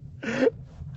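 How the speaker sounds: wheezing laugh. Real, not acted. Remembering the township meeting.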